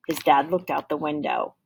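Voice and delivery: ordinary delivery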